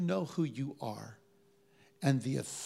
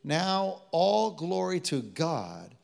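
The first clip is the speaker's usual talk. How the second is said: loud, raised, slow proclamation; rousing scripture declaration; 5 semitones higher, 5 dB louder than usual. Real, not acted. Preacher leads the congregation in saying it together, rousing. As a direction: proclaim it for the room to echo.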